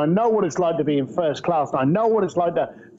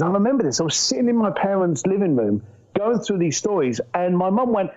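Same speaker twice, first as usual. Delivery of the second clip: ordinary delivery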